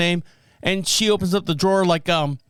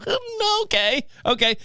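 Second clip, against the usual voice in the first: embarrassed realization; 14 semitones higher, slower than usual. Real, not acted. Realizes he's at wrong store.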